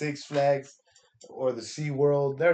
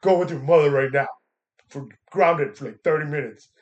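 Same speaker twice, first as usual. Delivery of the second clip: louder mock parental scolding; stern scolding; 5 dB louder than usual. Uncle mock-scolding the niece who wandered off, stern. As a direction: scold her like a strict parent. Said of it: "so I gave her a little scolding".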